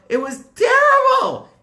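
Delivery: very high, loud cry; outraged shout. Acted. Teacher cries out to the children about slavery, very high and loud. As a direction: shout it out, horrified. Teacher stressing Egypt's cruelty.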